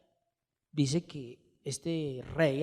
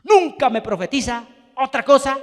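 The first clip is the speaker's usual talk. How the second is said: resentful complaint; 6 semitones higher, 12 dB louder than usual. Retold or imitated. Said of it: "exclamó el rey".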